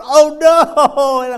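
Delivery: loud high cry of dismay; mock dismay. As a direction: wail it in retold horror. Seeing the damage.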